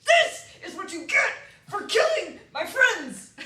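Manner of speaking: loud, slow vengeful shout; battle cry. Pretend. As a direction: yell it in revenge. Battle cry over killing blow.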